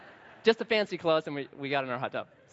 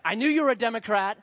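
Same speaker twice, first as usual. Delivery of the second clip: higher, louder, gloating heckler voice; 7 semitones higher, 3 dB louder than usual. Retold or imitated. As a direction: play a smug heckler catching him out. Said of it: "The rednecks in there are like".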